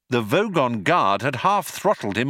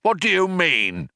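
higher, booming puzzled demand; 7 semitones higher than usual. Acted. Baffled Vogon guard questioning Ford, booming. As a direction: boom it out, baffled. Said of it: "he boomed".

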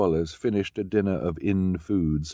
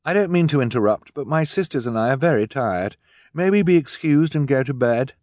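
put-on higher boyish plea; polite tired boy; 7 semitones higher, 6 dB louder than usual. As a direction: ask politely, a bit weary. Klaus asks to be excused.

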